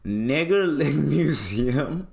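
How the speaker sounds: slow, laughing repeat of the slip; laughing disbelief. Retold or imitated. Mocking the slip while laughing. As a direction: laugh through the words in disbelief.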